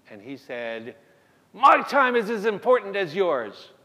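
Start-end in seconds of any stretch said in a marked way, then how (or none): 1.5-3.7 louder, slow, indignant retort; indignant shout. Retold or imitated. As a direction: throw it back at the doctor, offended. Angry father confronts the doctor.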